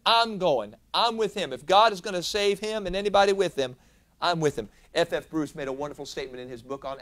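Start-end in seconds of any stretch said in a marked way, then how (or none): 0.1-4.7 eager declaration. Retold or imitated. Shipmates declaring loyalty.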